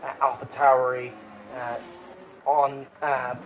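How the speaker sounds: halting, with filler uhs; a little tongue-tied, searching for the words. Real, not acted. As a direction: lose the thread and stumble on. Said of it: "I got tongue-tied a little bit".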